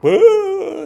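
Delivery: high, loud mock wail of ugly crying; hammed-up sobbing howl. Teacher imitating a classmate ugly crying as they flee. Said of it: "like, ugly crying".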